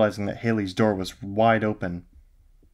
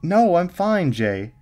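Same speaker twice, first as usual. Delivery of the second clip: laughing; 9 semitones higher, 6 dB louder, much slower than usual. A narrator's reading.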